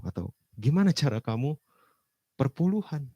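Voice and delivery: ordinary talk, nothing marked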